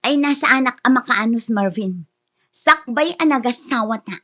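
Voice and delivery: ordinary delivery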